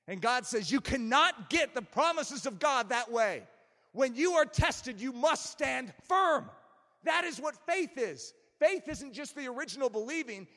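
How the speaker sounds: loud, rising-pitched sermon delivery; forceful preaching. Real, not acted. Preacher urges congregation to keep believing when tested, forceful preaching. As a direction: exhort them to stand firm.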